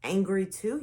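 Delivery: ordinary delivery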